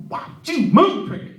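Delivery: high, loud tic-like outburst; involuntary blurt. Acted. Coworker with Tourette's asking Chris for a stack, sudden loud blurt. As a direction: blurt it out mid-sentence like a tic. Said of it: "he just blurted it out".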